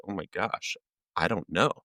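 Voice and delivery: small, quiet admission; retold stunned realization. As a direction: drop the voice in dawning dismay. Stunned reply to friend's question.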